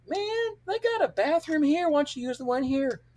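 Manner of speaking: high-pitched mock-innocent suggestion; imitating a buddy's high, needling voice; teasing buddy. Mocking buddy's urgent bathroom need.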